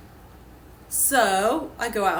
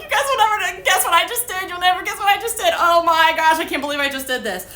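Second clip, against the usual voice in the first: high, breathless-sounding rush of disbelief; excited confession; 8 semitones higher, 3 dB louder than usual. Retold or imitated. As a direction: imitate bursting with big news. Retells confessing to friends, thrilled.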